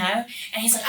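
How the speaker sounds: ordinary talk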